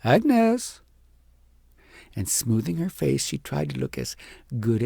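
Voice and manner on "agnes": higher and louder call across the hill; the wise woman calling for Agnes. Acted. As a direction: call her name from afar. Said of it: "the voice of the wise woman calling".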